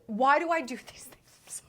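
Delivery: whispered self-reproach; sheepish breath-only aside; embarrassed whisper. Real, not acted. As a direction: whisper it to yourself, mortified. Embarrassed at own remark.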